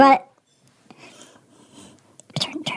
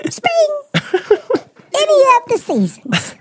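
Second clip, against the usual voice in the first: laughing fashion expert; 3 semitones higher than usual. Pretend. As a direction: break up laughing while listing the seasons.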